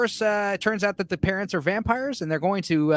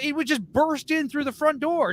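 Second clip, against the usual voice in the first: excited shout; 7 semitones higher than usual. Real, not acted. Werewolf attack story building.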